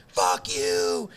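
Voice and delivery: high-pitched mock heckle; heckling fan. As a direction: imitate a fan jeering from the sidelines. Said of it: "they state on, on the side of us".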